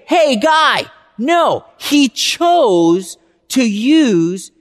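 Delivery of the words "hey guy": casual call out. Retold or imitated. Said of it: "He didn't say".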